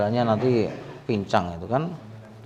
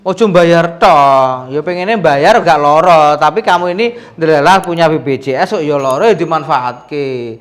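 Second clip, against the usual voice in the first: teasing scold; 4 semitones higher, 8 dB louder than usual. Real, not acted. Scolding patient to use insurance.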